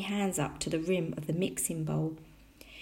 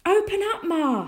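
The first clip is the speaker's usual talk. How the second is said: high, loud urgent call; small boy hollering at a shut door; shout; 12 semitones higher, 7 dB louder than usual. A narrator's reading. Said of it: "he shouted".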